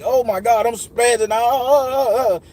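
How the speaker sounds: high, drawn-out retold wail; belligerent freak-out. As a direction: act out spazzing out in panic, stretching the end. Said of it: "I'm going fucking belligerent, like".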